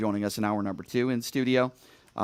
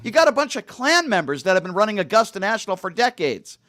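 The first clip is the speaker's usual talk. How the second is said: higher, louder indignant rant; serious rant; 11 semitones higher, 8 dB louder than usual. Real, not acted. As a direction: let the outrage rise in earnest. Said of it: "let me be serious here".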